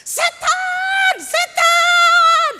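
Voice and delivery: terrified scream. Acted. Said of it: "anggota suku ini teriak".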